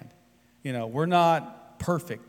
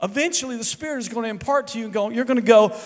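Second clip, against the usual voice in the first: raised, high, loud preaching voice; full-force preaching; 10 semitones higher, 9 dB louder than usual. Acted. Pastor urging the congregation to be patient, full-force preaching. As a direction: preach it out to the whole room.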